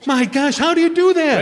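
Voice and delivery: high, loud wonder at a magic trick; amazed. Acted. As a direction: gasp it out, astounded.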